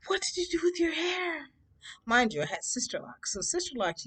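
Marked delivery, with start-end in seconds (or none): 0.1-1.5 high shocked cry from mom. Retold or imitated. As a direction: mom gasping at her daughter's cut hair, high shocked cry. Mom shocked at cut hair.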